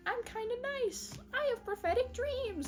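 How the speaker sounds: high, soft little-cat voice; put-on sweet, high voice; mock youngster. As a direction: imitate a naive youngster, pitched high. Silly son introducing himself.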